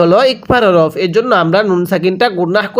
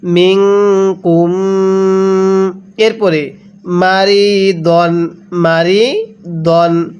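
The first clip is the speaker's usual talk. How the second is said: slow recitation of ফামান কানা with a held nasal; Quran recitation; much slower than usual. Shown as a demonstration. Teacher leads students through the verse, slow chant. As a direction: stretch ফামান কানা out slowly. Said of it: "তাহলে মিনকুম".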